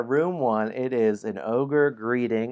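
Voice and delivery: ordinary talk, nothing marked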